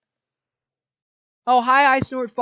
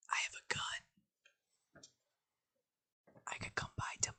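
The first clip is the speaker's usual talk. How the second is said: hushed secret whisper; 20 dB quieter, faster than usual. Acted. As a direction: lean in and whisper it. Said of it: "Arthur leans in quietly".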